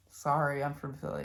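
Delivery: usual speaking voice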